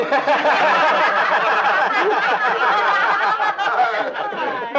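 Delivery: high loud laugh. Real, not acted. Laughs at punchline.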